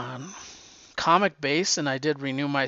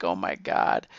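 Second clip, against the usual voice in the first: awed dismay; 9 semitones lower than usual. Retold or imitated. Reader reacting to Namor's killings.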